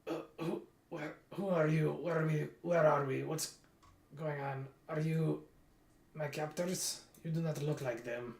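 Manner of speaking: put-on a light Russian accent, a dazed prisoner waking up; lightly voiced Russian prisoner, bewildered and questioning. Freed prisoner wakes and questions the adventurers, in a light Russian accent. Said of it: "I don't know why he's Russian".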